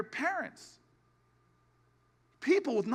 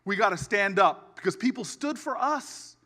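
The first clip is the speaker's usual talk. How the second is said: much louder, booming rally call; rally preaching; 3 semitones lower, 7 dB louder than usual. Acted. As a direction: lift the crowd to its feet. Rally close.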